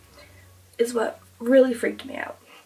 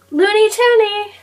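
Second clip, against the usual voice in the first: loud high call across the house; 10 semitones higher, 10 dB louder than usual. Retold or imitated. Mom calling the cat loudly from the living room. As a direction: sing out the nickname loudly. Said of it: "I hear her go".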